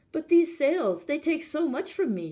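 high, loud desperate plea; pleading. A narrator's reading. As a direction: beg him to understand the cost. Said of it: "she pleaded".

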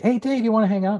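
imitating a kid, high and loud; eager kid voice. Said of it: "people be like".

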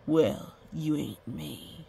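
slow, low, self-assured retort; cocky blacksmith. A narrator's reading. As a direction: stretch it out like a proud blacksmith. Cocky blacksmith answers the mayor.

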